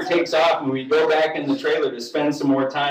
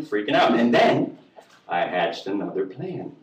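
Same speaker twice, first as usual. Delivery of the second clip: usual speaking voice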